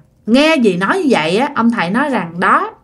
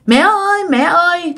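put-on higher, toddler-like call; two-year-old voice; 6 semitones higher than usual. Ghost child calling its mother in a toddler voice. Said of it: "theo cái kiểu hai ba tuổi á".

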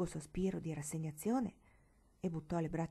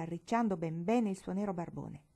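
ordinary delivery, nothing marked

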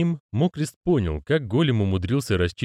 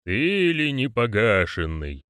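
growled; 2 semitones higher, slower than usual. A narrator's reading. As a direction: growl it like a stern elder. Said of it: "прорычал".

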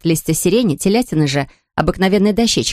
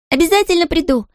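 calling back; 11 semitones higher than usual. Acted. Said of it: "прокричала ей в ответ Майя".

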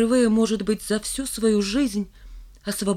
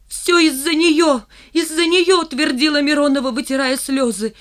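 high, loud tearful outburst; crying; 7 semitones higher, 6 dB louder than usual. A narrator's reading. Миронова cries out in tears to the class, high and loud. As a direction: sob it out, voice high and breaking. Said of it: "Голос у Мироновой сорвался, и она заплакала".

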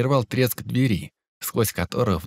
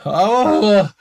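pained yelp; 7 semitones higher, 5 dB louder than usual. Acted. Beaten youth cries out.

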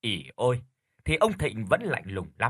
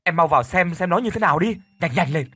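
urgent shout; 5 semitones higher, 6 dB louder than usual. Acted. Urgent order to check brother.

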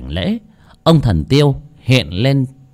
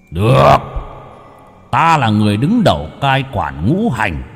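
booming like thunder; 4 semitones higher than usual. Acted. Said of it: "cất giọng ồm ồm như sấm".

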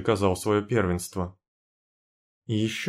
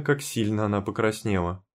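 plain reading voice

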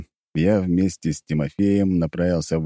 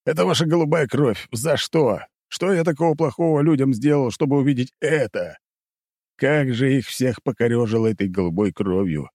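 exasperated; 5 semitones higher than usual. Acted. Exasperated inner complaint.

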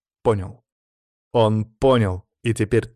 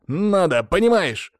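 high, insistent retort; snapping back; 8 semitones higher than usual. A narrator's reading. Sanya insists that Lyokha hold on, snapping. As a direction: press the point, pitched up and irritated. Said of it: "огрызнулся снова Саня".

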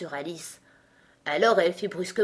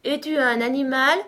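high, slow words stretched by yawns; yawning lion; 7 semitones higher, 6 dB louder, slower than usual. A narrator's reading. Weary lion questioning Alice, yawning. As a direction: yawn through each drawn-out word. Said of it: "demanda-t-il en bâillant après chaque mot".

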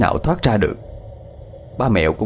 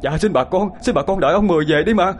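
begging, rising higher and higher; pleading; 8 semitones higher than usual. A narrator's reading. Kneeling son begs villagers to wait, rising plea. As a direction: beg the crowd, voice climbing in desperation. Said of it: "lại quỳ xuống van xin".

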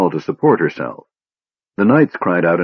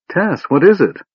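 high, urgent question; alarmed; 10 semitones higher than usual. A narrator's reading. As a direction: ask in sudden alarm.

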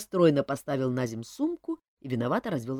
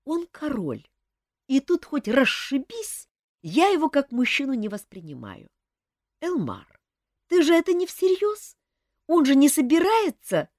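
put-on higher young woman explaining earnestly; young woman Olga; 10 semitones higher, 5 dB louder than usual. A narrator's reading. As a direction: voice the girl, higher and sincere. Olga alarmed by Elmar's question.

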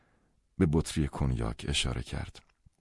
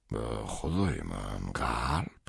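taken-aback father; 5 semitones lower, much slower than usual. Acted. Father startled at son's heart.

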